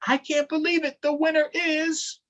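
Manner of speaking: high, building reveal; excited announcement. Acted. Storyteller revealing election winner, high and excited. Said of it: "Drum roll, please".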